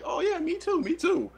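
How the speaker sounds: very high chirpy agreement; girl's voice. Retold or imitated. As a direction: chirp along cheerfully. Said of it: "She's like".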